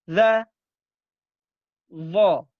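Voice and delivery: za said loud, tongue tip to upper teeth; Arabic letter za. Shown as a demonstration. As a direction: show the za sound clearly. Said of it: "Ingat, bertemu gigi seri". (za diucapkan dengan ujung lidah di gigi atas)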